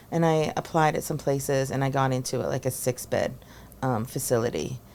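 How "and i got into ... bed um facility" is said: normal talking voice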